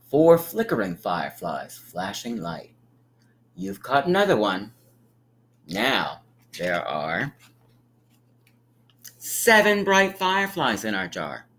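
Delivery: ordinary talk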